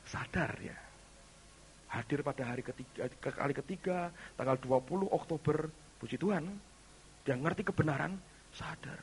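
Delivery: ordinary talk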